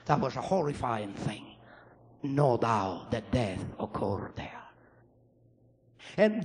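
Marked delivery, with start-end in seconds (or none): 0.1-4.6 whispered, soft and low; hushed horror. Real, not acted. Preacher recalling the crash, whispered. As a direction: breathe it out in awe. Describing smashed truck, hushed.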